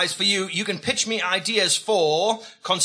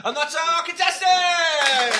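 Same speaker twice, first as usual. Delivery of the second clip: announcer flourish; 8 semitones higher, slower than usual. Acted. Host wraps intro.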